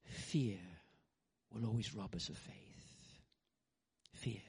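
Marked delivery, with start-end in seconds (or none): 4.1-4.4 whispered single word, hushed. Real, not acted. Preacher whispering the word fear to congregation. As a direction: drop to a whisper for weight.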